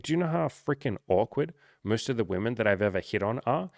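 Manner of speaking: ordinary delivery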